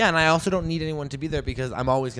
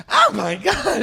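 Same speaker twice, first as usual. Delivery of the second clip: mock panicked mom gasping, higher and louder; 9 semitones higher, 6 dB louder, slower than usual. Mom driving through a storm panics in front of the kids, mock panicked gasp. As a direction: play the mom losing it in the storm. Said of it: "You don't want your mom to be like".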